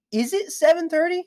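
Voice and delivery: "Is it seven thirty" pitched high with a rising end; question-mark reading. Shown as a demonstration. Texter unsure of the time, "Is it seven thirty" as a high rising question. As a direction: ask "Is it seven thirty" high, lifting at the close. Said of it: "seven thirty question mark is like".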